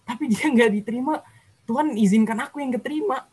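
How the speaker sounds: high-pitched retold amazement to God; awed disbelief. Telling God of the narrow margin, in high-pitched awe. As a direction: tell God in a high, incredulous voice.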